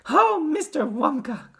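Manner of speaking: high, slow, loud wail; distraught mother's drawn-out plea. A narrator's reading. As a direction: wail it imploringly. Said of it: "wailed Missus Teavee".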